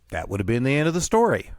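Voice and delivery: ordinary delivery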